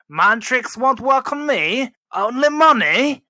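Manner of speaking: put-on loud, high-pitched, botched Middle Eastern accent; Watto accent imitation. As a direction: do a big, loud, slow-drawn bad impression of an alien trader's accent. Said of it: "I can do this accent".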